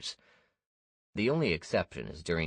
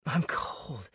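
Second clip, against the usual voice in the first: wheezing; 8 semitones higher than usual. A narrator's reading. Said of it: "he wheezed".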